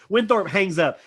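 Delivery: ordinary talk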